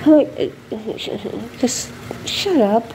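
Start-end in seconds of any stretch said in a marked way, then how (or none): none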